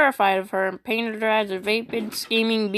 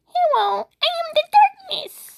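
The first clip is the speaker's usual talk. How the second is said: much higher, hammed-up villain declaration; mock dark lord. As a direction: announce yourself like a cute fake overlord.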